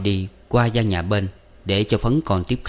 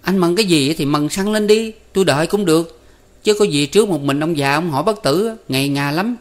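put-on higher boy voice; a kid nagging his elder; boy Năm; 8 semitones higher, 3 dB louder than usual. A narrator's reading. Năm urging Tư Cầu to hurry, higher boy voice.

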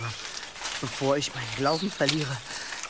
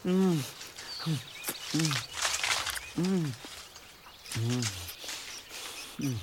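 eating hums; 7 dB quieter, slower than usual. Acted. Eating berries.

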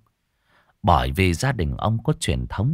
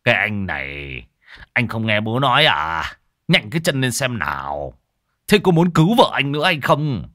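rising to a loud, higher scolding; annoyed shout; 4 semitones higher, 4 dB louder than usual. Acted. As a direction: snap at the dawdling son, building to a yell. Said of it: "Ông Bảo bực mình quát".

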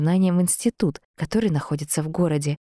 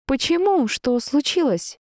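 high, startled questioning; bewildered; 10 semitones higher, slower than usual. A narrator's reading. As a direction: ask in puzzled alarm, pitch lifted. Heroine baffled by guards' order.

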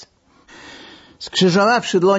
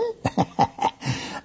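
a quick airy laugh; short breathy laugh; 7 dB quieter than usual. A narrator's reading. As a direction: laugh it off lightly. Laugh after joke about bugging.

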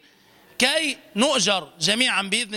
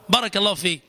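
usual speaking voice

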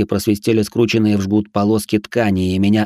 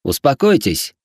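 stern; 4 semitones higher than usual. A narrator's reading. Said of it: "сказал я строго".

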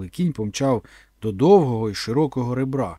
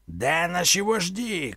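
put-on old chief shouting; 6 semitones higher, slower than usual. A narrator's reading. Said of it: "кричав старий вождь".

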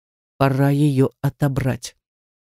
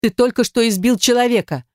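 higher voice, pressing the accusation; pressing, emphatic; 7 semitones higher than usual. A narrator's reading. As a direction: answer back with insistence. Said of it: "с нажимом ответила она". (с нажимом, настойчиво)

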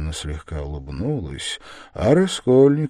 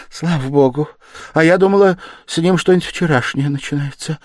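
relieved; 10 semitones higher, 8 dB louder than usual. Acted. Mother relieved after a scare.

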